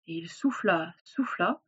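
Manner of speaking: softly narrated, dropping back; hushed suspense. A narrator's reading. Narrator as the wolf blows, hushed suspense. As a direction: hush it for suspense.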